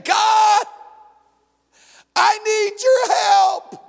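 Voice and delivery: high tearful wail for help; desperate plea. Acted. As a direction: cry out to God in distress. Believer crying out to God.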